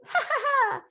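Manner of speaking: high-pitched mock laugh into the line; fun voice. Retelling a film brother's taunt, in a high fun voice. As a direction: put on a high, silly ha-ha voice. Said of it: "you did in your fun voice".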